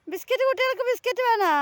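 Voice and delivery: quick, high pet-talk, stress on കുട്ടികൾക്ക്, falling at the end; fast high-pitched coaxing with a falling finish. Real, not acted. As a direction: rattle off the treat offer again, leaning on കുട്ടികൾക്ക്. Offering biscuits again.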